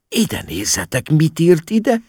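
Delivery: rising to a high, outraged pitch; indignant cry. A narrator's reading. Mama shows the family the officer's note, indignant. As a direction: exclaim in mounting indignation. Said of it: "kiáltotta méltatlankodva".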